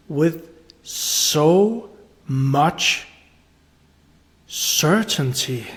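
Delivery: very slow, word by word; slow, weighty emphasis. Real, not acted. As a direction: stretch "so much" and pause before "certainty". Drives home the key point.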